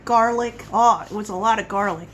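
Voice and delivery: ordinary delivery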